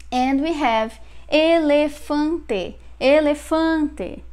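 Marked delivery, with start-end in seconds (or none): 1.3-4.2 "elefante" slow and higher, closed e's. Shown as a demonstration. Teacher presenting the word without accent mark, slow closed-e "elefante". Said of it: "but you pronounce closed".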